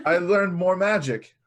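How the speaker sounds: proud little magician; character voice. Acted. Roman voiced, boasting.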